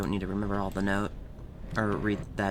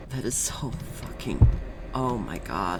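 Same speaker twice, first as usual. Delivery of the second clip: normal talking voice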